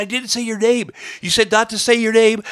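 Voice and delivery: high-pitched mock arguing back; defensive protest. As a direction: protest in an imitated, rising squabble. Acted argument back at journalist.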